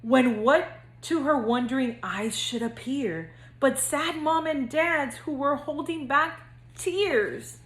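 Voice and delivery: lively singsong storybook reading; reading to children. A narrator's reading. Counselor reading to future kindergartners, bouncy storybook narration. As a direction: read it like bedtime story time for little kids. Reads picture book to kindergartners.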